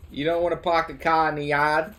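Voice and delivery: park-the-car Boston voice, put on; Boston accent. Teasing the idea that they sound like that, put-on Boston accent. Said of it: "accent that would make me sound like that".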